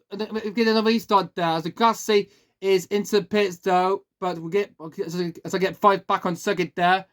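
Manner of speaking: usual speaking voice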